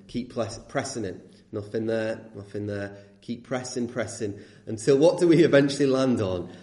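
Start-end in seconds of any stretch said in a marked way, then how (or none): 0.1-4.4 low, soft, absent-minded murmur; bored channel flicking. Acted. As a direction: play someone idly pressing remote buttons, much quieter.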